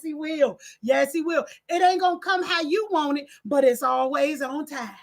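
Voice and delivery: usual speaking voice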